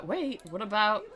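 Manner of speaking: ordinary delivery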